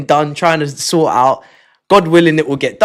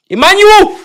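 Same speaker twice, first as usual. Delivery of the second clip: name cried out much higher and louder; disbelieving cry; 6 dB louder than usual. Real, not acted. Streamer yelps a donor's name in disbelief, high and loud. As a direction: yelp the name in shock. Shocked at gifts, calling name.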